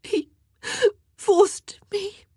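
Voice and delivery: high, broken tearful gasps; halting, sobbing confession; whispering through sobs. A narrator's reading. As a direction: force the words out between sobs. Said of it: "she whispered through her sobs".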